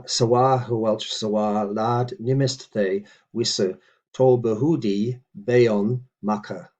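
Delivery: slow, deliberate word-by-word recitation; measured teaching read; careful Old English reading. Shown as a demonstration. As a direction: read each word out for the learner. Reads model sentence again.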